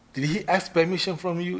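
high, loud interrogation; stern questioning. Shown as a demonstration. Queen Mother rehearsing, loudly interrogating the player. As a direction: press him for the truth.

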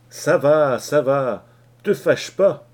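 placating. Acted. Parson calms angry Leach.

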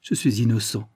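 ordinary delivery

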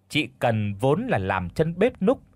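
ordinary delivery

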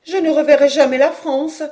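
high, loud despairing lament; appalled. A narrator's reading. Mother believes she will never see France, appalled. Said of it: "répétait madame Caudal atterrée".

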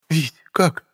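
высокий женский голос с вопросом; спроси тонким, заметно более высоким голосом; Вера. A narrator's reading. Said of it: "первой спросила Вера". (женская реплика в высоком регистре)

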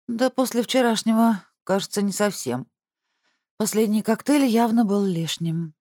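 hungover sister voice. Acted.

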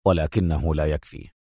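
low, resolute murmur; firm murmur. A narrator's reading. Adham says the success is not enough, firm. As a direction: state it low and firmly. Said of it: "غمغم أدهم في حزم".

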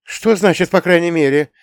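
high, alarmed demand; exclaiming. A narrator's reading. Mother challenges her son's evasive answer, exclaiming. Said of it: "воскликнула Камила Альбертовна".

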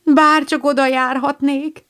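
high, longing cry of delight; Joe, thrilled by the Swiss school, exclaims; excited exclamation. Acted. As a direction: gush it out like an eager girl. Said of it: "kiáltott fel Joe".

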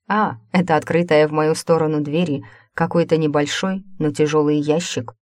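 usual speaking voice

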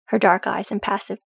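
ordinary delivery

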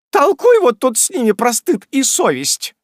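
high, loud indignant yell; крик. Acted. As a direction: shout it at her meek husband. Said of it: "закричала миссис Раддль". (жена кричит на мужа, высоко и громко)

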